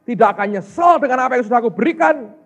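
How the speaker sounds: high, loud fervent prayer; a woman vowing to God not to grumble; woman's fervent prayer. Retold or imitated. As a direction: pray out loud with joyful resolve. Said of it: "Dan dia berkata".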